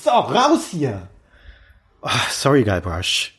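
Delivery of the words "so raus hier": alarmed shout. Acted. Dennis bites Guybrush.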